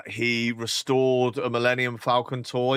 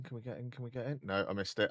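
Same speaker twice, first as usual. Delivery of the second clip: normal talking voice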